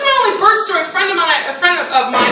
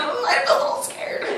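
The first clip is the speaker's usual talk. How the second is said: high breathless mock-scared whisper; timid whisper; 8 semitones higher, 8 dB quieter than usual. Comic mimicking a timid audience member, mock-scared whisper. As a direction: whisper it like a nervous little kid. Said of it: "there's at least one that's kinda like".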